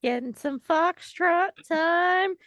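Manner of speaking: usual speaking voice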